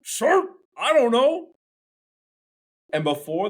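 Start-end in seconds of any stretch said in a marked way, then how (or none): 0.0-1.5 muffled through a mouthful. Acted. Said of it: "said all muffled".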